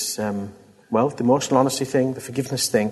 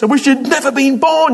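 high, loud mock rant at the kids; angry parent; 12 semitones higher, 6 dB louder than usual. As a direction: snap it at the kids in anger. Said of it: "you say to your kids".

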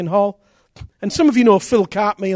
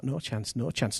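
inner refusal; 6 semitones lower, 13 dB quieter, slower than usual. Retold or imitated. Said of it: "he thought".

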